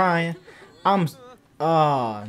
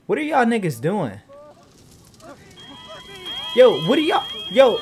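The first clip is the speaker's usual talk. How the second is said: higher, louder, rising disbelief; incredulous yell; 9 semitones higher, 6 dB louder than usual. Real, not acted. Gamer yelling at his sims, incredulous. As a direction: call out at them in disbelief. Sims doing weird things.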